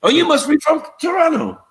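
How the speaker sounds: high-pitched mock guess; mimicked surprise. Stranger guessing the visitor is Canadian, mimicked high surprise. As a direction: imitate a delighted local, voice way up.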